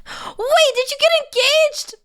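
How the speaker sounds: gasp, then high and loud; shocked and thrilled, voice jumping up; surprised. Real, not acted. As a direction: streamer learning a viewer got engaged, high surprised squeal. Finds out viewer engaged.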